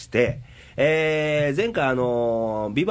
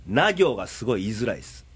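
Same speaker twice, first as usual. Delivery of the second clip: blocked-nose voice, muffled n sounds; nasal voice; 4 semitones lower, faster than usual. Real, not acted. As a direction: speak with a stuffy nose.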